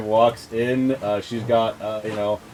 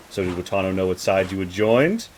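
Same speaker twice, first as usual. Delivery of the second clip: normal talking voice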